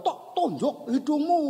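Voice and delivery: high echo of a threat; imitated distant answer, high-pitched; echo answering back. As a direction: imitate the ravine throwing the call back. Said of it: "Sana jawab".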